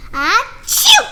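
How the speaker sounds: loud pretend achoo; a comic sneeze; acted sneeze. Gerald sneezes at the cat.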